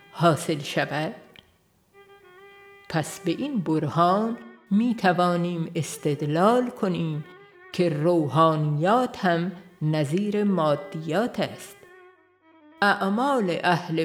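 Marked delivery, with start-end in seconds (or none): none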